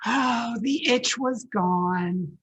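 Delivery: plain reading voice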